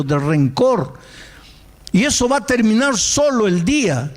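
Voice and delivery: high, fervent exhortation; preacher pleading with the bitter young man; impassioned preaching. Acted. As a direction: drive the warning home like a sermon.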